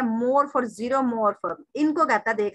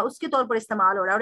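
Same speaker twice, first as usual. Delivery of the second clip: normal talking voice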